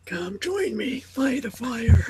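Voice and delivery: slow, high, soft character voice; mock character impression. Mimicking an actor's silly voice, soft slow invitation.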